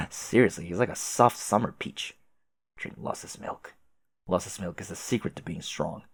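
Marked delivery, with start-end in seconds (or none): 2.8-3.7 breathy whisper, no voice at all; whispered command. Acted. Reading command line aloud.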